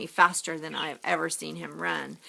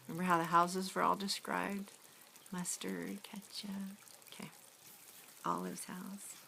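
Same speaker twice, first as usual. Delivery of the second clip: much softer, half-whispered reminder; hushed aside; 11 dB quieter than usual. Acted. Reader quietly reminding the listeners of the houses. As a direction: drop to a near whisper to the listeners.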